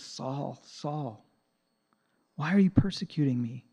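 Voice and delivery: gently grieved plea; compassionate. Retold or imitated. Jesus calling to Saul on the road, soft and compassionate. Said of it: "I think he had compassion".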